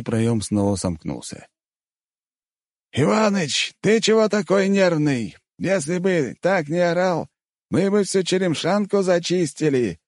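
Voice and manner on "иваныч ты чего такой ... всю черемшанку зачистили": very high, cheeky character voice; chuckling Mongol fighter. A narrator's reading. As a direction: brush off the boss in a high, jaunty voice. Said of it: "хохотнул Чингис". (весёлый, задиристый высокий голос)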